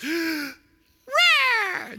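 high, squeaky little cub roar; Simba. Acted. Cub showing off for his girlfriend, high puny roar. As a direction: try to scare them with a feeble roar. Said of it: "he's gonna scare them and frighten them. So he goes".

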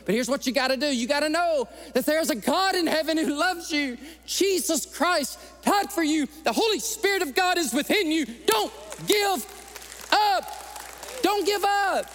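high, loud, impassioned preaching that builds; full-force preaching. Acted. Preacher urging the congregation never to give up, high and loud. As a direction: preach it hard and rising, pleading with the church.

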